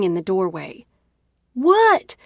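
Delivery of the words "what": a sudden much higher, louder shout; scream. A narrator's reading. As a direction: blurt it out at full volume, fed up. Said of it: "I scream".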